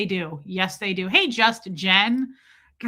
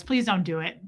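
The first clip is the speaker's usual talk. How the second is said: usual speaking voice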